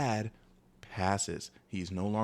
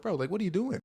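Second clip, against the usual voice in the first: indignant; 9 semitones higher than usual. Retold or imitated. Confronting friend after push.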